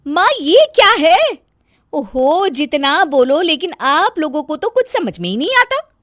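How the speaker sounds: high, loud complaining bahu voice; bossy bahu fussing over nothing; nagging daughter-in-law. Acted. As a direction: scold the whole family, shrill with irritation. Daughter-in-law nags family.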